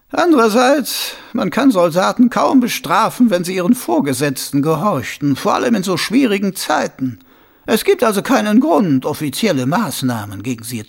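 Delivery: a scheming noble, savouring his idea; sly, high-pitched lordly voice; scheming Lord Schnappüber. Acted.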